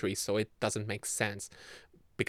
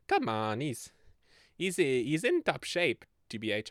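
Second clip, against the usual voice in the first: ordinary delivery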